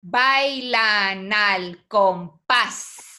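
"Bailan al compás" very slow, every syllable stretched. Shown as a demonstration. Teacher asking how many syllables it has, syllable by syllable. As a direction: count it out, one long syllable at a time. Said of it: "¿cuántas sílabas tiene?".

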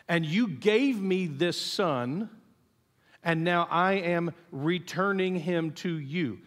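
slow, measured, weighing each word; unhurried words of a mother's prayer; deliberate, solemn offering. Acted. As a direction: say it slowly, as a heartfelt handing back. Voices Hannah handing back her son.

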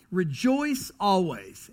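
"Rejoice always" drawn out and weighted; slow emphatic quote. Shown as a demonstration. Pastor walks the church through the first command, slow and weighty. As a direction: say "Rejoice always" slowly, leaning on "Rejoice". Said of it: "The first is this".

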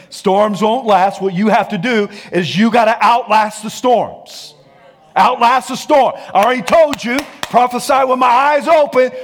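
steady loud preacher's push; loud preaching. Acted. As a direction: drive the warning home from the pulpit. Preaching at full force.

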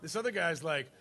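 ordinary delivery

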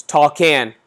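loud bark of an order; yelled drink order at the bartender; brother's yell. Retold or imitated. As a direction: call it out loud across the bar. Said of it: "And he yells to the".

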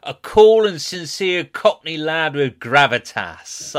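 lightly hamming up a casting blurb; mock gravitas. Man reading his friend's casting description, slow and mock-grand. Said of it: "what do they describe it as?".

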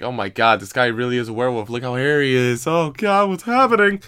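freaked-out neighbors spotting a werewolf; imagined neighbor panic. Pretend. Neighbors imagined seeing werewolf vomit.

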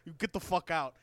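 lightly done godfather voice; a hint of a mob boss; Marlon Brando. Host imitating the stranger as Marlon Brando, lightly. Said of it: "some Marlon Brando shit".

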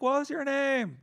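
high, loud yelled question; shouting to be heard in a bar. Acted. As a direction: yell it over loud music.

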